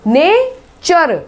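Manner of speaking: नेचर very slowly, NAY-cher with ch; drawn-out नेचर stressing cher; slow careful pronunciation. Shown as a demonstration. Teacher repeats the word slowly for students, ch. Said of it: "देखो चर".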